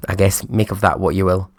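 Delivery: ordinary delivery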